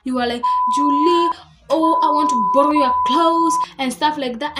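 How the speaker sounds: loud, fast and rising; furious comeback at the false friend; angry retort. Retold or imitated. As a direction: let it fly at her.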